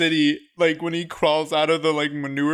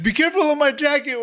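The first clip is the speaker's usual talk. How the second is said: quoting character; 10 semitones higher, faster than usual. Retold or imitated. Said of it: "he's like".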